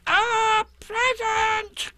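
tiny high squeal, stretched right out; Piglet, very loud. A narrator's reading. Piglet making hard-of-hearing Eeyore hear, high drawn-out shout. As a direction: shout it slowly in an extremely high voice. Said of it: "said Piglet very loudly".

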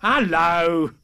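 loud, high storybook animal hello; boisterous animal calling hello; strange animal. A narrator's reading. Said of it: "said the strange animal".

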